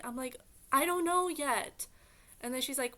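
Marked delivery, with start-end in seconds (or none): 0.7-1.9 louder, higher snippy retort; annoyed retort. Retold or imitated. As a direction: fire it back, irritated and a bit loud. Annoyed reply to mom.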